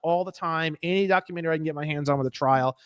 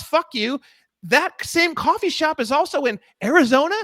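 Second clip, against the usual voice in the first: ordinary delivery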